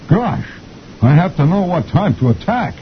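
high cartoon captain voice; fretting captain. Captain fretting over lost orders, high and flustered. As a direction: squeak it out like a flustered officer. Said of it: "the captain says".

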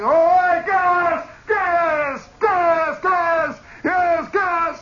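repeated high, loud gas alarm; yelling gas. Retold or imitated. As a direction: hammer out the gas call over and over. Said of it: "He's yelling".

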